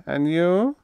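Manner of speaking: high, rising little question; lifted, piping politeness. A narrator's reading. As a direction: pipe it up cherubically. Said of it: "he piped".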